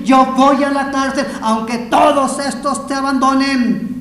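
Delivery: loud, high, impassioned pledge; drawn-out, vehement preacher's shout; fervent vow. Acted. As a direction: swear loyalty at the top of your voice. Peter's fervent vow, acted out.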